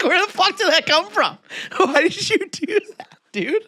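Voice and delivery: high-pitched incredulous, laughing protest; baffled, high and laughing; mock outrage. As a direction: demand an explanation, voice shooting up. Mock outrage at showy croissant order.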